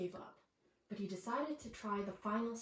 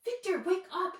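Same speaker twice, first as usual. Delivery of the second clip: mother shouting her son awake; loud urgent wake-up call; 9 semitones higher, 5 dB louder than usual. A narrator's reading. Mother bursting in to wake Victor, loud shout. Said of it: "shouted his mother".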